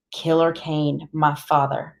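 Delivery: plain reading voice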